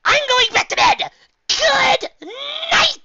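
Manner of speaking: very high, loud squeaky voice; mayor's voice. Acted.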